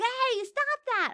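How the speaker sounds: high, slow, loud yell; stretched-out high shout; shouting stop. Pretend. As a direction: call out to stop the attacker. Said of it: "I'd be like".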